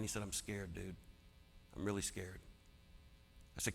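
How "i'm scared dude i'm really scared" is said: a hushed, understated admission of fear; quietly scared. Retold or imitated. Dying friend confessing his fear, hushed and understated. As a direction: let the fear slip out softly, without crying.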